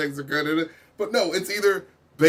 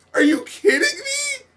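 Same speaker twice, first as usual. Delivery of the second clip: much higher, whiny, near-crying rant; exasperated disbelief. Real, not acted. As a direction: cry out in comic disbelief at the track. Ranting in exaggerated disbelief.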